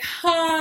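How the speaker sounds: loud open-mouthed sigh sound. Shown as a demonstration. Tapping coach showing the release sound, a loud sighing ha. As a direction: let out a big breathy Ha with mouth wide. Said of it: "make a sound like".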